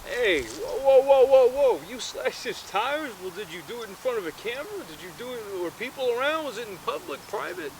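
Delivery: high-pitched, frantic fatherly questioning; a dad alarmed by his son's crime, but mainly asking if anyone saw; dad's voice. Retold or imitated. Dad grilling his son, high and alarmed. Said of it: "the dad says".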